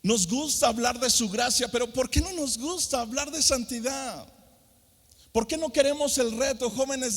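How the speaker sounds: put-on a preacher challenging young people; fervent preaching. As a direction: push the challenge to the youth. Preaching at full force.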